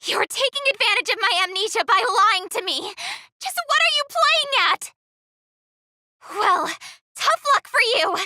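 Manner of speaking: loud, indignant shouting; angry accusation. Acted. Adventurer furiously accuses the player of lying. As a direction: throw the accusation in his face.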